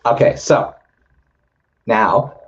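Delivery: hoarse, breaking voice; losing voice. Real, not acted. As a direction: voice cracking, nearly gone. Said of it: "seem to be losing my voice".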